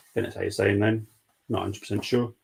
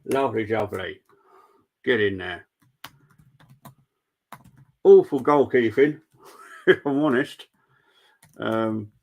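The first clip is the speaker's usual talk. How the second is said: pen in teeth; 4 dB louder than usual. Real, not acted. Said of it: "I got a pen in my mouth".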